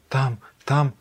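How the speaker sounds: ordinary talk, nothing marked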